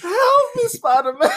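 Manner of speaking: high squealing plea, laughing through it; hammed-up squeal for help; mock old lady cry. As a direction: camp it up as a helpless old woman. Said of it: "That's not what he said".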